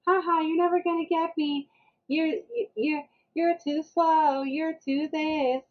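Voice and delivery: high, drawn-out playground taunt; taunting child. Retold or imitated. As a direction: mimic a kid teasing in a chase. Imitates son's taunting chant.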